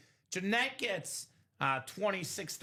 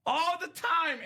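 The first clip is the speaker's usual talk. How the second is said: exasperated; 9 semitones higher, 4 dB louder, slower than usual. Retold or imitated. Scolding aunt, retold.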